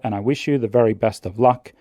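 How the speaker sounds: ordinary talk, nothing marked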